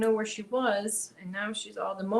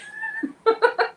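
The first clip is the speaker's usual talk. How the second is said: a sudden high squeal of laughter at the cat; 7 dB louder than usual. Real, not acted. Laughing high at her tangled cat.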